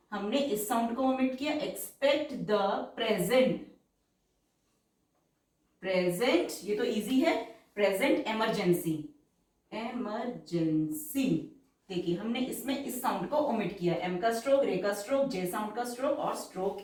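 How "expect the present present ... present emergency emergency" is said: very slow, drawn-out dictation pace; stenography dictation, stretched syllables. Shown as a demonstration. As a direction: read it out word by word for shorthand.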